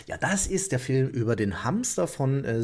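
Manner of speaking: ordinary talk, nothing marked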